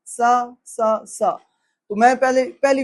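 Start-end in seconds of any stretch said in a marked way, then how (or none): 0.1-1.3 every "سا" the same plain sa; three identical "سا", no difference between letters; wrong identical sounds. Shown as a demonstration. Teacher showing a major recitation mistake, all three "سا" alike. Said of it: "اس طرح یہ لحن جلی ہے".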